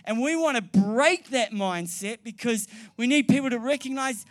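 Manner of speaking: loud, driving preacher's voice at full volume; full-force preaching. Acted. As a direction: preach it hard to the whole room. Preaching builds, shouted conviction.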